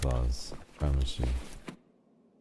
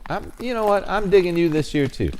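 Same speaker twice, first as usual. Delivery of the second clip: much higher, sing-song teasing; 13 semitones higher, 4 dB louder than usual. Pretend. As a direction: coax him in a high playful voice. Chasing Santa NPC.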